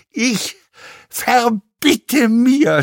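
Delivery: high, strained, drawn-out cry; croaked scream. A narrator's reading. Actor protests the insults, screaming in a croak. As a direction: try to scream but only croak, word by word. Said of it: "schrie er. Aber es kam nicht als Schreien heraus, nur als ein seltsames Krächzen".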